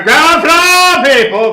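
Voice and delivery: high, slow, loud floor call; announcer yell. Pretend. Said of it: "We gotta yell in the elevator".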